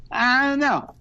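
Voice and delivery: high, loud, blank I-don't-know; mock clueless shrug. Mocking a player who can't say why he's in the hand, high and blank.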